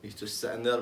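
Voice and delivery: ordinary talk, nothing marked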